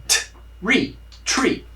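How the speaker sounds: T then re, then tree joined; tree split apart. Shown as a demonstration. Teacher breaking tree into sounds for students. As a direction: build tree from T and re. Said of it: "Esse é o som".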